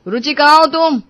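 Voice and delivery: loud call, falling at the end; angry calling. Acted. Husband calls again for his wife, irritated and loud. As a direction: demand where she is, irritated. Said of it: "गुस्से में वो अपनी पत्नी रुचि को आवाज लगाने लगता है".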